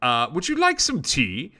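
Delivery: genteel offer with swooping pitch; Mister Fancy Pants. Acted. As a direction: play a fancy elephant host offering refreshment. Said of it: "Mister Fancy Pants looks at you and goes".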